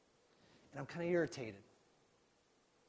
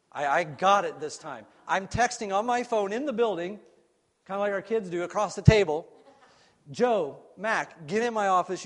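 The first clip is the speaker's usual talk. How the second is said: excited; 4 semitones higher, 11 dB louder than usual. Retold or imitated. Excited retelling, louder.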